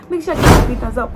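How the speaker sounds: fast, loud call to action; rushed plea. Real, not acted. Asking viewers for a thumbs up, fast and loud. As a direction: rattle it off quickly and loudly.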